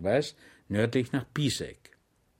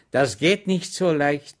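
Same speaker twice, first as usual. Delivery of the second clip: slow, a bit louder, unhurried refusal; Schweig declining; 5 semitones higher, 6 dB louder than usual. Acted. Schweig refusing the deserters, slow and easy. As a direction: turn it down calmly and slowly.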